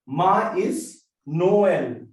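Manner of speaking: Ma-is, No-el, slow and loud, each vowel apart; vowels pronounced separately. Shown as a demonstration. French teacher demonstrating the trema, Ma-is and No-el split slowly. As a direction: split Ma is and Noel into two clear syllables each. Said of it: "pronounce them separately, we use this accent".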